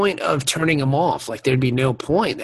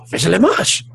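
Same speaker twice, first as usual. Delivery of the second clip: mock French, rising; 4 semitones higher than usual. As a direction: fake French, ending on a rise. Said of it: "That's the only French I know".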